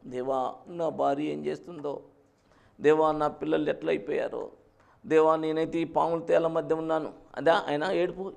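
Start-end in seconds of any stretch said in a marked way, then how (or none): none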